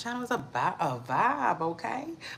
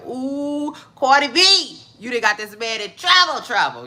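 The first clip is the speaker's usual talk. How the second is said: loud, higher mock warning; retold exclamation; 6 semitones higher, 7 dB louder than usual. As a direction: call out a teasing "uh-oh" reaction. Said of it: "I said".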